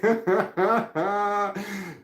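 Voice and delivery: real laugh breaking out high. Real, not acted. Laughing at own story.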